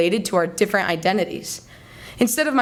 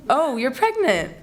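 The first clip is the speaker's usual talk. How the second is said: acted delight; 6 semitones higher, slower than usual. Acted awkward mistaken congratulation.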